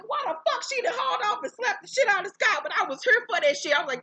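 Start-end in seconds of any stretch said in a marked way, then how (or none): 0.1-2.6 high disbelieving squeal. Retold or imitated. Continued shocked disbelief.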